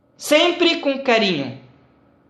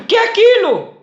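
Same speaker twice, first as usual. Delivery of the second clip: raised, much higher voice; loud repeated question; 10 semitones higher, 4 dB louder, faster than usual. Retold or imitated. Old father asks son again, loudly. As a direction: ask it louder this time. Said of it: "Começou de aumentar o voz".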